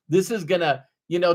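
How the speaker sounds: normal talking voice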